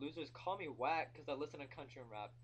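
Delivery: ordinary talk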